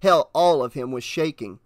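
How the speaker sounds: ordinary delivery, nothing marked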